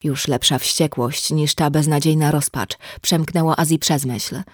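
ordinary delivery, nothing marked

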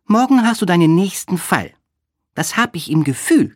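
high-pitched, encouraging friend; Olga. A narrator's reading. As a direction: cheer him on in a high voice.

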